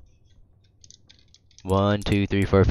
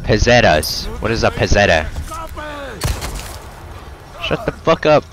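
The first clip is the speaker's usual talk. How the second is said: ordinary talk, nothing marked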